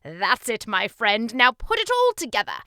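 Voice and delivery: rally him loudly to finish; excited king. Acted. King urges boy to combine sounds, excited booming.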